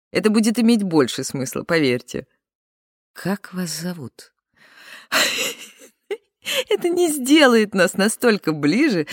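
normal talking voice

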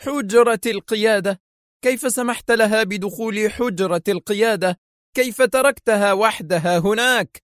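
high-pitched, outraged questioning; thunderstruck exclamation. A narrator's reading. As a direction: reel in shock, demand how. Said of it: "تراجع ديليشمسكي كالمصعوق وهو يحدق".

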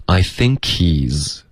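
slow, I think he's run together smoothly; slow linked demo. Shown as a demonstration. Teacher showing students the linking, slow and smooth. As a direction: link think into he's, slowly. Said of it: "会连读，变成".

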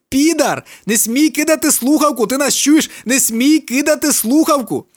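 high, loud roar down the phone. A narrator's reading. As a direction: bellow the order. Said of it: "реве в слухавці".

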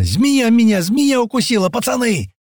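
very high, alarmed cry; panicked yell. Acted. Bitten Паштет cries to his mates, panicking. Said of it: "заорал на весь лес Паштет".

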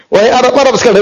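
high-pitched mock calling out; imitated loud call. Lookout hailing all the Arabs, imitated high call. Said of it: "dengan suaranya yang paling kencang dia berkata".

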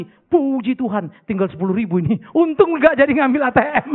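loud, hammed-up thanks to God; mock relieved inner thought. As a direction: act out a relieved giver's secret thought, loudly. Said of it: "jangan bilang begini dalam hati". (pura-pura lega, suara batin yang dilebih-lebihkan)

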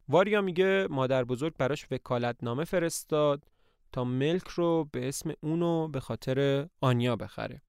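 ordinary delivery, nothing marked

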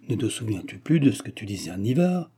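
plain reading voice

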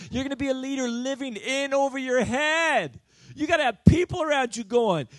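loud, high, fervent sermon; loud, rising altar-call preaching; preaching at full force. Acted. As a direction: preach it out to the back row.

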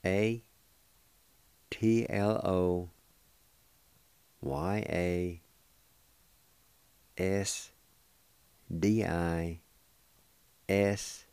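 T L O spelled slowly, one letter at a time; slow spelling. Shown as a demonstration. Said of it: "And it's spelled this".

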